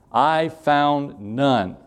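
very slow, heavy verdict; drawn out. Shown as a demonstration. Preacher stressing God found no one, very slow. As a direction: stretch every word for weight.

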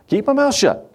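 high-pitched mock protest; mock helpless plea. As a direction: plead it high, sending yourself up. Acting out helpless plea to God.

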